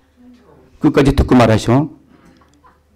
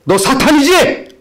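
stern challenge; 11 semitones higher, 3 dB louder, slower than usual. Retold or imitated. Pastor confronting possessed girl.

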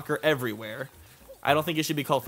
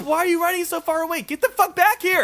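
much higher, louder exasperated yell; higher frustrated shout at the screen; yelling at a game enemy; 13 semitones higher, 6 dB louder than usual. Real, not acted. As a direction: holler at the Chain Chomp to come back.

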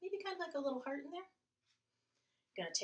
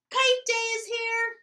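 much higher and louder calling out; excited call; 9 semitones higher, 12 dB louder, slower than usual. Acted. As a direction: call across to a friend. Bear calls out to Mole.

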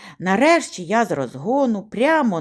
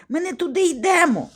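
high, mildly breathless protest; задихано; 8 semitones higher than usual. A narrator's reading. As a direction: object while short of breath. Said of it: "майже задихаючись". (високо, трохи задихано)

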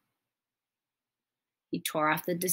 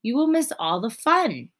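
urging; 6 semitones higher, 6 dB louder than usual. A narrator's reading. Frog pleading with Toad.

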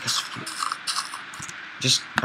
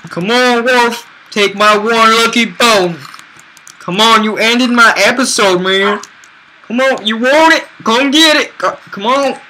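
higher, coaxing pet voice; 6 semitones higher than usual. Real, not acted. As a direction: lure the dog in sweetly. Coaxing a wolf with a bone.

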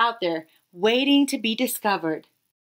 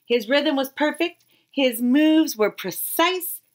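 usual speaking voice

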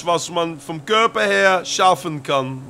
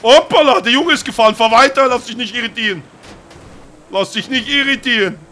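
higher, louder hype; excited cheering; 4 semitones higher, 6 dB louder than usual. Acted. Father cheering his son driving the getaway car. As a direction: cheer the driver on.

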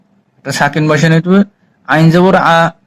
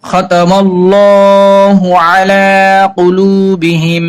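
drawn-out melodic tilawat, each vowel held long; slow Quran recitation; 2 semitones higher, much slower than usual. Shown as a demonstration. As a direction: recite slowly like a tajweed teacher. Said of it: "teacher models slow Quran recitation". (ধীর সুরেলা তিলাওয়াত)

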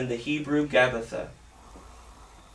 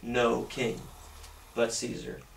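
low, slow mock reply of the chief priests; lightly voiced priests, drawn out and dropping away; 4 semitones lower, 5 dB quieter than usual. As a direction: priests rejecting their king, said low and slow. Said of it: "and they say".

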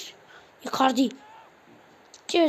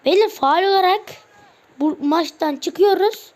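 louder, higher announcement of quitting; loud protest; 5 semitones higher, 5 dB louder than usual. Real, not acted. Gamer tells viewers he is quitting over a foul, loud protest. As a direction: declare it loudly, fed up.

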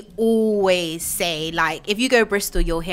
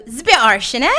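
Bristolian accent; 2 semitones higher, 5 dB louder, faster than usual. Shown as a demonstration. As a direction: teach Bristolian "It's a bit harsh", words run together. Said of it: "We go".

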